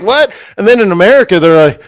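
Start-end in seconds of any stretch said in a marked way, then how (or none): 0.0-0.3 incredulous what. Retold or imitated. Incredulous reaction.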